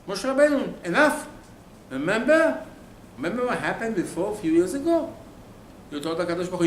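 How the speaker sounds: loud, high-pitched telling-off; fed-up scolding. Acted. Acted scolding of Moshe.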